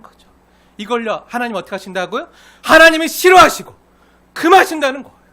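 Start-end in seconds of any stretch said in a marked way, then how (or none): 2.6-5.2 high, booming pulpit emphasis; forceful declaration. Real, not acted. Preacher declaring what God forbids, loud and forceful. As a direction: thunder it out to the congregation.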